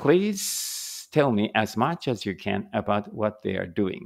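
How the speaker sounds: slow, careful English reading for learners; slow read-aloud. Shown as a demonstration. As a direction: read each word out slowly and clearly. Reads examiner prompt slowly. (ゆっくり丁寧な英語の音読)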